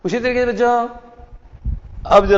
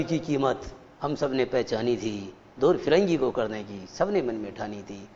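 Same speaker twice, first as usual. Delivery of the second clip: soft poem recital; 6 semitones lower, 10 dB quieter than usual. Shown as a demonstration. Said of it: "recites poem lines".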